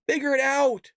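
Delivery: ordinary delivery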